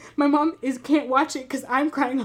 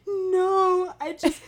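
higher, crying mock wail of no; imitated crying; 8 semitones higher, much slower than usual. Crying friend in the movie theater, high mock wail. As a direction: whimper it like sobbing in a theater. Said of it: "audibly saying things like".

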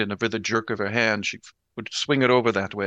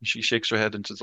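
usual speaking voice

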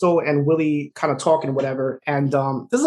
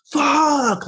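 sudden higher cry of frustration; frustrated outburst; 10 semitones higher than usual. Retold or imitated. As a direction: blurt it out like you've had it with everything. Jack hangs up, overwhelmed.

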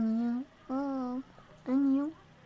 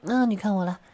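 ordinary delivery

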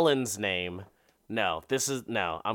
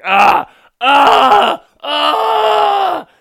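escalating shrill screams, ever higher and louder; mock screaming; 17 dB louder, much slower than usual. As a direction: scream ridiculously like bad sex. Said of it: "They're literally just screaming".

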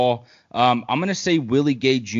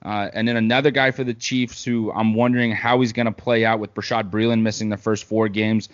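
normal talking voice

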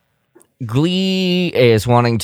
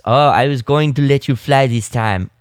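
higher-pitched mock French accent; 5 semitones higher than usual. Host quoting Glee talking to Hera about flying, mock French accent. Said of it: "impression of a French accent".

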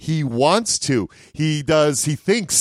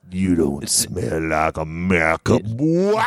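low, menacing accusation; suspicious father; 8 semitones lower than usual. Acted. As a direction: sniff him out, low and threatening. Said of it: "And he's like".

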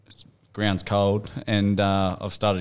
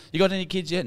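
high mock relative asking; pitched-up mock relative; nosy relative; 10 semitones higher than usual. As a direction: squeak it like a prying aunt. Relatives quizzing at wedding.

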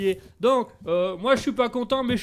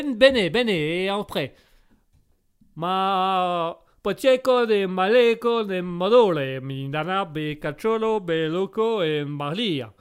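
slow gibberish in a hammed-up Italian accent; sing-song mock Italian, drawn out; 2 semitones lower, slower than usual. Examiner rambles in hammed-up mock Italian. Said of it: "Tellement mélodieux".

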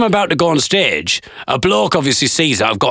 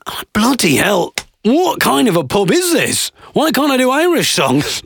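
higher, drawn-out bafflement; exasperated disbelief; 6 semitones higher than usual. Retold or imitated. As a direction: protest in baffled indignation. Indignant reaction to the warning.

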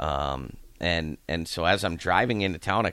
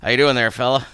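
put-on hearty old Texan greeting, higher and louder; friendly old man; 6 semitones higher, 10 dB louder, faster than usual. Old man upstairs greeting the newcomer, hammed-up folksy call. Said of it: "and he goes".

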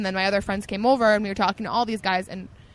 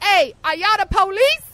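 put-on Southern accent; 10 semitones higher, 6 dB louder than usual. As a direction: play up a Southern accent, teasing. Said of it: "Are you Southern?".

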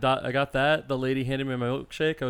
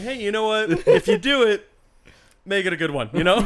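higher, louder mock pep talk; Sam's sure voicemail; 6 semitones higher, 6 dB louder than usual. Retelling a friend's voicemail to the group, mock sure. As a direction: imitate a friend sounding breezily sure. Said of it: "Man sounded sure".

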